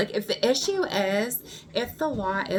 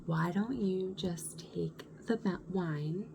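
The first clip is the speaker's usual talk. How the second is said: hushed, patronising whisper; condescending whisper; 2 semitones lower, 8 dB quieter than usual. Retold or imitated. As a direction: whisper it as if to a child. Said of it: "kinda talking to me like this".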